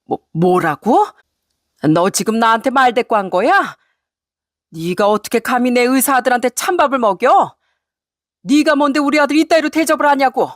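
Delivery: indignation climbing to a high-pitched rebuke; enraged mother-in-law. Acted. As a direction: scold the daughter-in-law for talking back. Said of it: "이내 화를 참지 못했는지". (분을 못 참는 시어머니)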